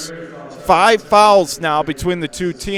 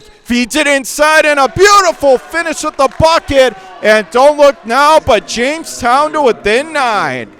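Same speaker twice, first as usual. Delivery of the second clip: excited call; 8 semitones higher, 6 dB louder than usual. Real, not acted. Basket cuts the lead.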